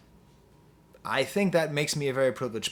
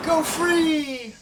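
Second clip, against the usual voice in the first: cheery call; 12 semitones higher than usual. Real, not acted. Calling out to dog.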